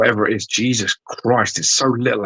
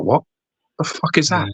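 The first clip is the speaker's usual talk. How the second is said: baffled; 8 semitones higher than usual. Retold or imitated. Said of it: "you're like".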